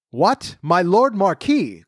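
high, slow surprised exclamation; surprised cry. Acted. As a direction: exclaim it in wonder. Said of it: "cried the king".

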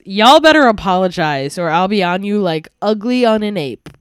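loud, mock [name] delivery. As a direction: belt it out like [name] pretending to be Sandy. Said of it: "I tried to say it in, like, a [name]-like voice".

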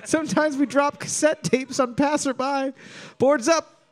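high, thin bird voice begging; trapped bird voice. Pretend. Trapped Tower ravens calling for help in a high bird voice. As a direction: squeak it like a caged bird.

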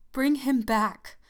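slow, loud pleading cry. Acted. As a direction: cry it out, dragging him along. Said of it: "cried the old woman".

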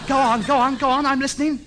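loud, insistent urging; urgent encouragement. Acted. Radio ham urging sailor to continue, loud and insistent. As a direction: urge him on loudly.

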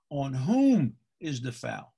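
slow, drawn-out stress on "whom"; pedantic correction. Retold or imitated. Official correcting the coach's grammar, slow stress on "whom". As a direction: stretch "whom" like a grammar stickler. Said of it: "He should have said".

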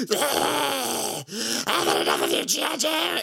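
high, hissing cartoon villain shriek; screechy snake-villain rant; Cobra Commander voice. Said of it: "the Cobra Commander".

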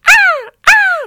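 loud, high male seagull squawk; piercing mock gull call, twice; seagull impression. Shown as a demonstration. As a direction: squawk like a seagull calling across a parking lot. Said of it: "That's my male seagull impression".

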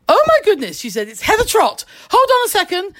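woman recognising a star, voice pitched well up; surprised woman. Retold or imitated. Woman at window recognises her.

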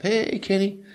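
put-on high-pitched Southern accent greeting. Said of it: "he had this Southern accent".

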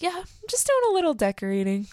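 high, quick fake-innocent voice; mock innocent.

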